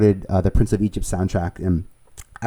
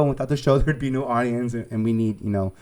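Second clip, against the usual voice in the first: ordinary talk, nothing marked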